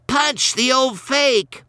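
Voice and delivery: put-on a spoiled kid urging his dad to fight; bratty kid. A narrator's reading. Ronnie goading his dad to hit Santa, high and bratty.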